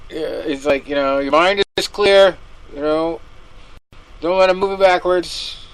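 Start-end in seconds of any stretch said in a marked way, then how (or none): 1.2-5.7 louder, higher imitation of a cornerman; lightly acted corner instructions, pushed up; mock coach pep talk. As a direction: parody a coach's empty motivation. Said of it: "ragtime shit he was telling him".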